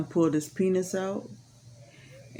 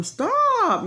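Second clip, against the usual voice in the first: a much higher, louder yelled stop; much higher desperate shout of stop; retold holler; 5 dB louder than usual. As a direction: holler it out as she relives it. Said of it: "hollering and telling him".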